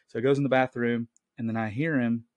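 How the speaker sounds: ordinary delivery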